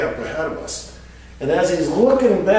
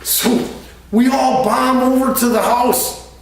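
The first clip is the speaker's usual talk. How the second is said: ordinary talk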